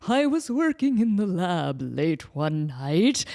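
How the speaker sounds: low, slow spooky crooner; deep theatrical spooky narrator. Retold or imitated. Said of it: "the theatrics of Monster Mash".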